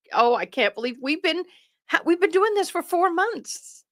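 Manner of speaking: real delighted astonishment; happy disbelief. Real, not acted. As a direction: marvel at it, voice lifting high. Amazed at four-month membership milestone.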